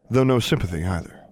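plain reading voice

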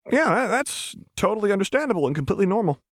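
high, hurried, stumbling assent; anxious humouring; nervous agreement. A narrator's reading. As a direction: agree too fast, voice climbing. Chuck nervously humours Jinx.

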